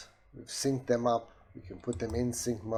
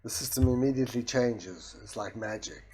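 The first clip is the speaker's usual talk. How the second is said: ordinary delivery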